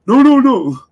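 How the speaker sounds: high, loud caricature squeal; mock whiny protest. Streamer mimicking rival's panic, high loud squeal. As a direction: whine it like a caught troll.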